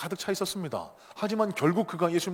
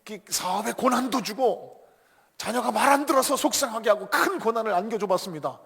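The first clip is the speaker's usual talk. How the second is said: higher-pitched eager minion reporting; acted henchman, building higher and louder; underling's report; 6 semitones higher, 5 dB louder than usual. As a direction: list your schemes proudly to the boss. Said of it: "두 번째 졸개가 와서 또 이렇게 보고를 합니다".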